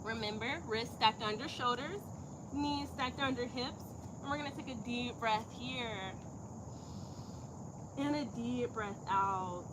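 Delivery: soft, measured yoga teacher voice; yoga instruction. Real, not acted. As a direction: guide the class quietly through posture and breath. Leads yoga breathing and posture.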